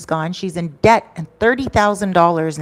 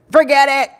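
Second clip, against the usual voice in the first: higher, louder dismissive cry in her voice; mimicking upset friend; 8 semitones higher, 7 dB louder than usual. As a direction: toss it off as the distraught friend. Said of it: "so I'm just gonna mimic her".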